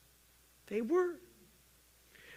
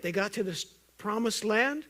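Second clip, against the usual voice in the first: ordinary talk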